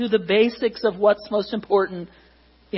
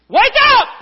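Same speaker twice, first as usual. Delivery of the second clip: high, full-blast yell; piercingly high shouted command; very loud shout; 12 dB louder than usual. Acted. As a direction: bellow a wake-up call at top volume. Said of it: "That's not even loud".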